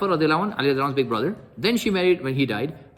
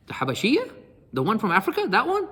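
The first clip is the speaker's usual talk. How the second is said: higher, rising incredulous questions; pointed questioning; 8 semitones higher than usual. Retold or imitated. Father asks daughter about her friend, incredulous questioning. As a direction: check with mock disbelief, pitch climbing each time.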